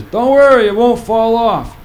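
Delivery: high, loud, slow reassurance; father-in-law voice. Retold or imitated. Father-in-law reassuring him, high loud slow voice. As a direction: play the old father-in-law calming him. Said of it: "he says".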